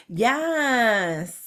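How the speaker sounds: stretched, sassy yass; sassy drawn-out yass. Shown as a demonstration. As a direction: draw it out with sass. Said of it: "but they say".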